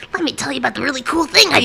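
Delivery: lightly playing a bragging cartoon girl; Pepper Ann impression. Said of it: "Pepper Anning".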